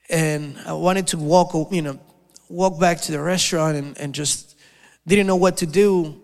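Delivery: ordinary talk, nothing marked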